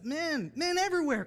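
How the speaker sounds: loud, high preacher's call; rallying call. Real, not acted. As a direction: summon the men with a rousing shout. Rallying the men in church.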